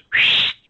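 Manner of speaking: a sharp, loud breathy whistle sound; imitated whistle. Pete's one-finger whistle startling the crowd, imitated. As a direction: blast a piercing mock whistle.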